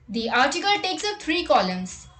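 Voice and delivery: ordinary delivery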